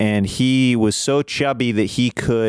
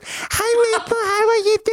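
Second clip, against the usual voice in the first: much higher sing-song dog-greeting voice; high-pitched greeting. Retold or imitated. Uncle teasingly greeting the wiener dog Maple, much higher voice. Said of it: "doing that voice".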